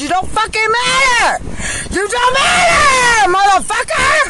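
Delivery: yelling, voice cracking into tears; screaming it at them with raw rage; furious shout. Real, not acted. Ranting at corrections staff, a tearful furious shout.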